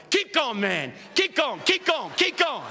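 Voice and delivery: high-pitched urging call; punchy, repeated church call-out; men's yell. Retold or imitated. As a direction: egg the preacher on from the pews. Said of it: "they point at you and they yell".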